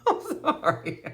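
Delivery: breaking up laughing; laughing sorry; laughing apology. Real, not acted. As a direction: giggle through the apology.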